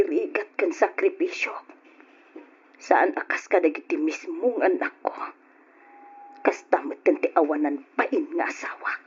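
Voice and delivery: low, soft, near whisper; bitter hush. Acted. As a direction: spit the resentment quietly. Bitter hushed complaint about family.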